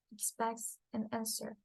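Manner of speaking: normal talking voice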